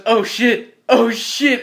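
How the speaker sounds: mock alarm. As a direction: play a sudden scare. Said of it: "your heart drops and he goes".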